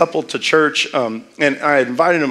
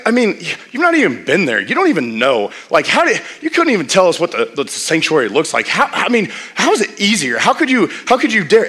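upset rant; 5 semitones higher than usual. Retold or imitated. Retold upset friend venting.

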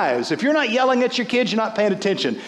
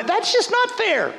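high whiny mock protest; whiny complaint; 13 semitones higher than usual. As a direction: whine it like a sulking child. Mocking Martha's complaint.

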